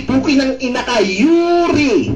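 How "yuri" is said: Yuri drawn out on the oo; emphatic Yuri with u, not o; corrected name pronunciation. Shown as a demonstration. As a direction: say Yuri as a long YOO-ree, pitched up then falling. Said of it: "Hindi Yori".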